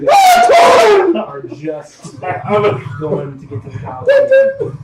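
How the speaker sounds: high, loud, dragged-out hype; hammed-up breathless excitement; excited shout. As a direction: stretch it out like a big reveal. GM hypes arrival at Coliseum.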